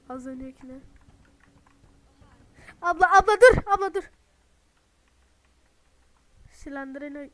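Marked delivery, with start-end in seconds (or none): 2.8-4.0 loud, high, desperate yell; panicked shout. Real, not acted. To the chasing woman, a panicked loud shout. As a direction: shout at her to stop, panicking. Monster chasing him in game.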